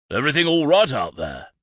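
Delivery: loud, high shout from the window. A narrator's reading. Father shouts from the carriage window, loud. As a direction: call out from the carriage. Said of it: "his father shouted".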